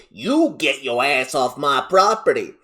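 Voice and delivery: put-on thick Southern accent, angry order; exploding Southern mom. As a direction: throw them out in a hammed-up Southern twang. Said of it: "Entitled Mom explodes and says".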